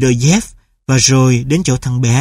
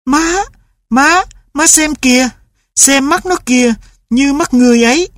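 put-on much higher, eager child's voice; excited boy; 10 semitones higher than usual. A narrator's reading. Boy shows mother the clever dog, much higher and excited. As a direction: beg mother to look at the dog.

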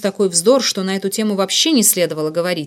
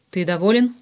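ordinary delivery, nothing marked

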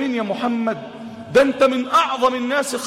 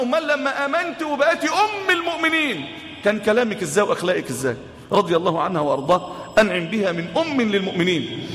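usual speaking voice

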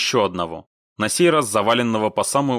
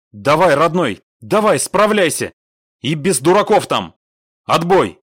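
loud, raised, forceful send-off; radio order; 7 semitones higher, 7 dB louder than usual. A narrator's reading. Commander sending squad leader to cope, loud. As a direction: urge him on and sign off firmly.

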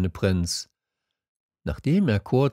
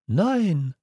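put-on higher, childlike story voice; little prince; 9 semitones higher than usual. A narrator's reading. Little prince confiding in the fox, higher voice. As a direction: voice a small prince, pitched up.

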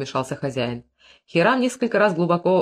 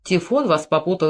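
usual speaking voice

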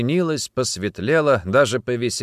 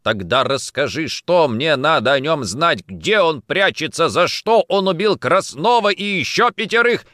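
loud questioning building higher; forceful, mounting interrogation; heated demand; 6 semitones higher, 5 dB louder than usual. Acted. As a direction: fire questions at her, escalating. Interrogator shouting back.

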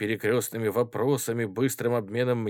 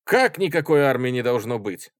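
youthful indignant exclamation, louder; exclaiming; 3 semitones higher, 7 dB louder than usual. A narrator's reading. Schoolboy objecting to having no army, exclaiming. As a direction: burst out in loud surprise. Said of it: "воскликнул совсем юный вихрастый гимназист".

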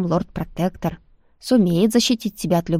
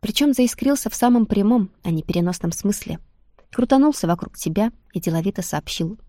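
usual speaking voice

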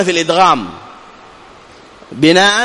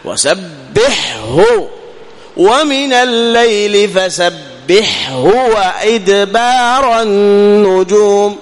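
slow chanted وسبحه with a clear ح; slow recitation; 3 semitones higher, much slower than usual. Shown as a demonstration. Teacher recites the verse slowly to model the letter. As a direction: recite slowly, letting the ح ring. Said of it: "تظهر الحاء".

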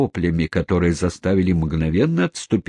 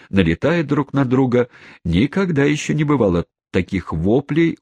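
plain reading voice

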